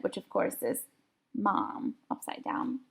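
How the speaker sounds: breathy whispered aside. A narrator's reading. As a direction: drop to a whisper.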